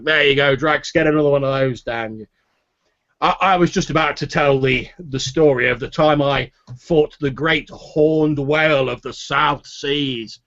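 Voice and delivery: put-on hearty old boaster starting his story; boisterous tavern storyteller. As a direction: launch into a sailor's yarn, stretching the ending. Haller begins his tall tale.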